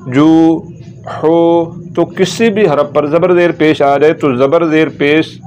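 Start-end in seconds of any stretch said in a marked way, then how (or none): 0.1-1.8 "जू" and "हो" each held long; over-lengthened "जू", "हो"; drawn out. Shown as a demonstration. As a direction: stretch the vowels of "जू" and "हो". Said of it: "हरफ को लंबा नहीं करना".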